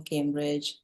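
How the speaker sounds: usual speaking voice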